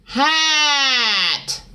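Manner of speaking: Hat! called out high and loud with pinched twang; twangy shouted hat. Shown as a demonstration. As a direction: bark Hat! in a bright, nasal twang. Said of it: "try saying the word hat".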